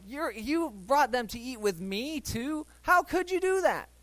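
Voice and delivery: rising to a high indignant How could you; mock outrage. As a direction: build to scandalized disbelief. Voicing outraged host.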